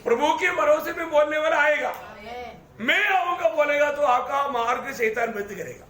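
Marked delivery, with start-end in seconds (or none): 2.8-3.5 higher, mock-confident declaration; boastful promise. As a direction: imitate someone sure of himself, pitched up. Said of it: "आपके अंदर घमंड है". (घमंड भरे दावे की नकल)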